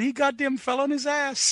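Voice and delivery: high put-on British voice; quoting a wrestler. Friend retelling a backstage yell, mock British. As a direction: mimic a brawler yelling from backstage. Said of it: "somebody in the background was going".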